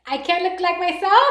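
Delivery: high, loud, laughing disbelief. Real, not acted. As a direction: burst out laughing while asking. Bursts into laughter reading chat.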